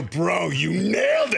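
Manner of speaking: slow, drawn-out hype; teasing over-the-top cheer; mock congratulations. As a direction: stretch out the praise in glee. Retold gleeful congratulation text.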